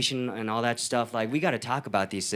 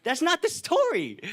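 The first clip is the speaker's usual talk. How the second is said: a high, slow, firm refusal; emphatic no; slower than usual. Retold or imitated. Refusing investors' demands, high and slow emphatic no. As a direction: turn the investor down flat and pointedly. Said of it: "I'm like".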